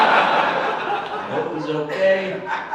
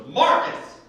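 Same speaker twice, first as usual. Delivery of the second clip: woman on the phone; 9 semitones higher than usual. Retold or imitated. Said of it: "and this woman goes".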